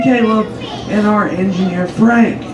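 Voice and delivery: ordinary delivery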